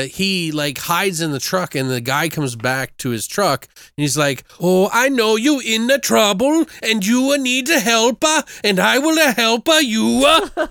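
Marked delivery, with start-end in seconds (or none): 4.6-10.7 high, mock Swedish sing-song; exaggerated Swedish immigrant impression; Swedish accent. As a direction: do a hammed-up Swedish accent with extra vowels tacked on. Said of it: "who's talking like this, like, Swedish, like, accent".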